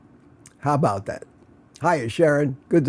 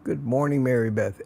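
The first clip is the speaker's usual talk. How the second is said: ordinary talk, nothing marked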